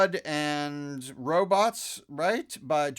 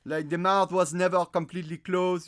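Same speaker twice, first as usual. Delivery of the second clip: higher, louder French-accented English; 4 semitones higher, 6 dB louder than usual. Shown as a demonstration. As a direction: explain in a slack French accent. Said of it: "doing the French accent".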